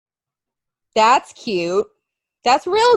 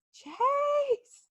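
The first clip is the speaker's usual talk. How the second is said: high, soft imitation of a shouted name; Jay; 10 semitones higher, 9 dB quieter than usual. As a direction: mimic the drawn-out call, pitched up but held back. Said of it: "with how you say".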